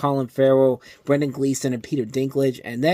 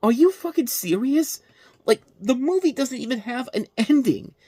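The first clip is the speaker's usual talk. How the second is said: incredulous; 9 semitones higher than usual. Retold or imitated. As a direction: ask it like you can't believe it. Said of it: "It's just like".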